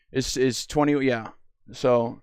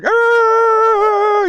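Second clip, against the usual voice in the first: monster roar; 7 dB louder than usual. Retold or imitated. Monster growl in game description.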